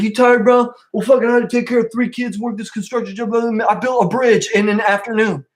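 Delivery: high, bragging mock bro. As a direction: brag like a know-it-all who built a bridge. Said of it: "It's like".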